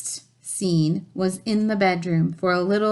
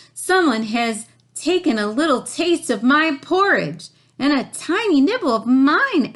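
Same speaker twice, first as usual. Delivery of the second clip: raised voices at the empty bowls; Papa and Mama Bear; 6 semitones higher, 4 dB louder than usual. A narrator's reading. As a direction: cry out over the missing porridge.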